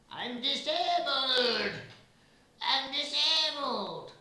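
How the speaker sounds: shrill high wail, drawn out and very loud; shrieking protest. Acted. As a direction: shriek it like a wounded victim. Fritz shouts, showing wooden leg.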